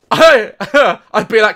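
usual speaking voice

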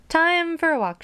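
high, eager sing-out; excitedly calling everyone to go; eager announcement. Acted. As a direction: pipe up high, ready to go. Jumps up, announces a walk.

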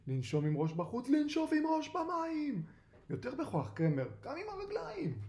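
coaching yell climbing high; urgent high instructor's shout. A narrator's reading. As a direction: drive the swimmer on with high-pitched commands. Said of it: "ובוזו צועק".

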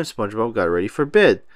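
ordinary delivery, nothing marked

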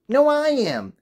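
many high shrill squabbling voices; loud, high, bickering cats crying over each other. A narrator's reading. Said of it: "cried hundreds and thousands and millions and billions and trillions of voices".

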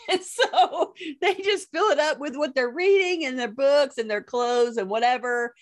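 high, laughing delivery; amused, laughter lifting the voice; laughing while talking. Real, not acted. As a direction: talk through giggles. Laughing at the nightstand habit.

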